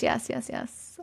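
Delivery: quiet unvoiced whisper. Real, not acted. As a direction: breathe it out under your breath. Quick aside to chat.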